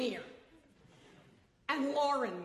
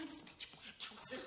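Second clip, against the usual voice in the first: whispering; 10 dB quieter than usual. Acted. Whispered fear in tent.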